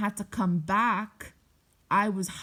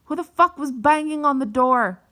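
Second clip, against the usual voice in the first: loud, high imitation of an angry scream; retold scream at full volume; screaming mom; 7 semitones higher, 10 dB louder than usual. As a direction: yell it like an outraged mother. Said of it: "I hear her screaming".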